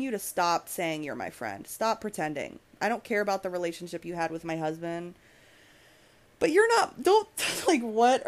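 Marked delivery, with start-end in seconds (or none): 6.4-8.3 high, loud, sputtering disbelief; incredulous outrage. Real, not acted. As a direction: burst out as if you can't believe the nerve. Incredulous rant at the absent enemy.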